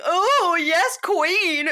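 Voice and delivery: high, loud sassy "yes, queen"; mocking Michelle's drag brunch gushing. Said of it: "talking like she was at a drag brunch".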